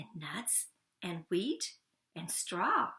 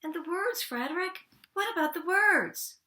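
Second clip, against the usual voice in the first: high and loud, rising to a cry; eager plea; 10 semitones higher, 6 dB louder than usual. Acted. The mice beg Frederick for the words, high and loud. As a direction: beg for the words, climbing louder.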